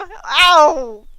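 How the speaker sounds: a short, loud fake yelp of pain; mock child scream. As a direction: yell it like a hurt seven-year-old, hammed up. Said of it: "He's gonna scream".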